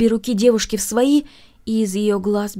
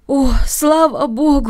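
relieved cry; 5 semitones higher, 6 dB louder, slower than usual. A narrator's reading. Masha relieved it was a dream.

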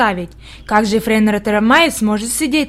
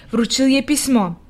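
ordinary delivery, nothing marked

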